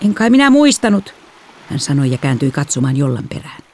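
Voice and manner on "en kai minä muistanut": put-on high, childlike excuse; lightly voiced younger girl; defensive little sister. A narrator's reading. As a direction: answer back as a kid caught out. Sister excuses herself in quarrel.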